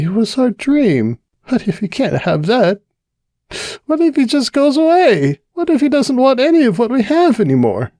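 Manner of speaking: high, tearful, rising to near cries; Cupcake frightened. Acted. Cupcake afraid Carrot will leave, high and tearful. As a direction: fear spilling out, voice climbing.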